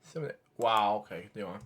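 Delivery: low muted aside; very quiet. Real, not acted. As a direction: murmur continue on low. Host goes very quiet.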